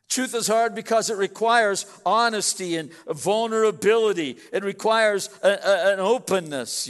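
sermon emphasis building on destroy; preaching. Acted. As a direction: press the point hard to the congregation. Pastor drives home his point.

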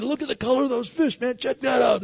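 high, fast, thrilled outcry; excited. Retold or imitated. Pastor reliving seeing the fish, excited shout. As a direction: point and shout with delight at the fish. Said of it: "and I'm all excited".

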